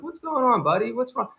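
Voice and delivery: high, soothing mock therapist; gentle psychologist voice. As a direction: coax the troll like a patient. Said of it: "almost psychologist kind of approach them".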